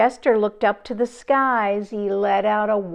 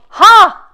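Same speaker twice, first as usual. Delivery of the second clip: loud, high hearty ha; big burst of laughter; 9 semitones higher, 14 dB louder than usual. Acted. Narrator bursting into the girl's full laugh.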